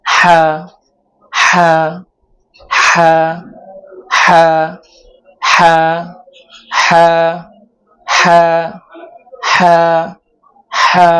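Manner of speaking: হা from mid-throat with light pressure, mouth spread; demonstrating ha. Shown as a demonstration. Teacher drilling হা, throaty demonstration. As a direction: press হা gently from the middle of the throat. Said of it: "মুখটা একটু ছড়িয়ে হাটা উচ্চারণ করতে হবে".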